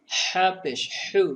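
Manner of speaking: হা পেশ হু squeezed from the throat, slow; হু pushed out with a tight throat; throat-pressed হা. Shown as a demonstration. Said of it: "গলা চেপে চেপে বের করতে হবে". (গলা চেপে হা পেশ হু)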